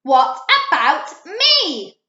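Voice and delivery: loud, slow, indignant cry; loud slow indignant screech. A narrator's reading. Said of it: "she screeched".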